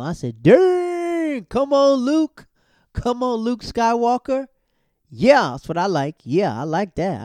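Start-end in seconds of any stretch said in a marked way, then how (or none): 0.4-4.5 very high, loud whoop of approval; gleeful high-pitched hollering; hyped cheering. Real, not acted. Cheering Luke's comeback. 5.1-7.2 loud, punched-out delight; hearty loud endorsement; emphatic approval. Real, not acted. Applauding Luke's comeback.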